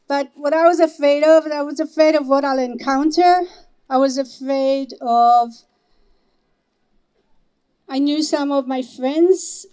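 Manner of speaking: ordinary talk, nothing marked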